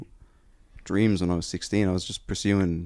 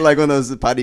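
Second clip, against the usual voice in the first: ordinary delivery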